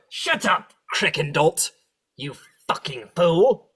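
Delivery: high, loud sneering devil insult; loud, slow scornful jeer; devil snapping. Acted. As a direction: bark it at the dim underling. Devils berate Crickendalt.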